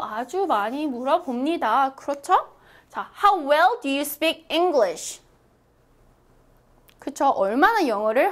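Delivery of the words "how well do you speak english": very slow, clear dictation pace; teacher modelling a sentence slowly; slow model reading. Shown as a demonstration. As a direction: spell it out slowly for learners. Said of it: "model sentence for repeating".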